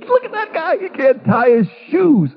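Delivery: mock the kid from the stands; mocking taunt. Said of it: "people are".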